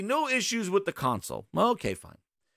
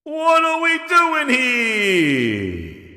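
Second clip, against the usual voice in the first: loud, drawn-out cry of disbelief; higher, stretch every word out in exasperation; exasperated outburst; 6 semitones higher, 8 dB louder, much slower than usual. Real, not acted. Streamer interrupts the clip he played, loud drawn-out exasperation.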